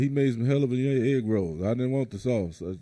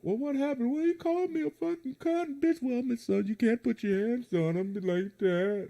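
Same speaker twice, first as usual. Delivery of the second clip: mock officer; 8 semitones higher, 4 dB quieter than usual. Officer questioning family.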